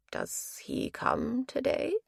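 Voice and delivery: an ailing husband murmuring to his wife; frail old man. Acted. Frail elderly Ralph speaks.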